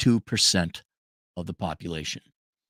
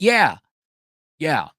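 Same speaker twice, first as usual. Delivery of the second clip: normal talking voice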